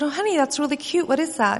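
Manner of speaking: mother admiring her daughter's drawing, high and quick sweet praise; sweet mom voice. Retold or imitated. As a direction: coo it to your little girl like a proud mom. Mother chatting brightly to daughter.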